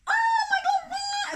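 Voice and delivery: very high, loud mock shriek; acted shocked scream. Imitating everyone as the idol group walks in, shrieking shock.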